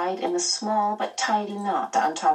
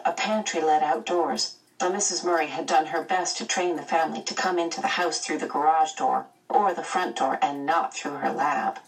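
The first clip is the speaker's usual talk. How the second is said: plain reading voice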